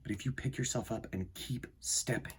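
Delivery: soft, measured storybook reading; reading to children. Acted. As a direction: read it gently to a young audience, letting lines land. Reads picture book aloud.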